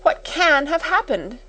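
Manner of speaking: very high, plaintive wail; tearful sniffling. A narrator's reading. Timid sister begging her sister for news, tearful. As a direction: plead as if about to cry. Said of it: "she sniffed".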